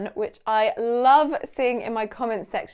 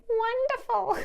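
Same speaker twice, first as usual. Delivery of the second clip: much higher mock chorus of students; mimicking students; 13 semitones higher than usual. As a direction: tease the teacher in a high singsong. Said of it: "they'd say".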